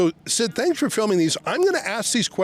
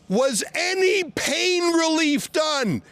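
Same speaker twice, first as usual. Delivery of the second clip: calling out; 8 semitones higher, slower than usual. Real, not acted. Calling questions to police cars.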